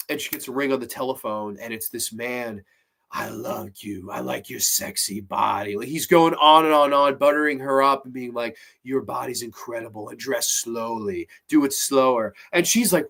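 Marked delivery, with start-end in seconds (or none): 3.1-5.8 low, creepy breathy-free stalker voice, lower pitch; creepy caller. Retold or imitated. 8.8-12.4 creepy caller. Retold or imitated. Stalker caller voiced again.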